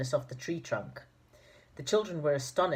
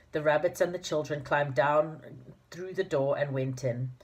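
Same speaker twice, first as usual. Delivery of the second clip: usual speaking voice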